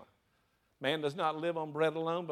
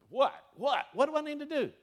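mock panic; 11 semitones higher, 4 dB louder than usual. Panicked self-questioning after Scripture worry.

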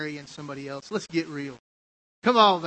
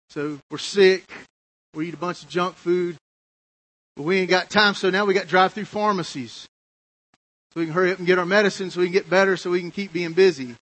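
louder, driving preacher cadence; preaching at full force; 2 semitones higher, 6 dB louder than usual. Real, not acted. Preacher rousing the congregation about busyness. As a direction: hammer home the point to the congregation.

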